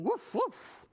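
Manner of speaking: high double woof; two quick, high imitation barks; barking. A narrator's reading. Storyteller showing children the dog's call, high barking. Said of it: "The dog was barking".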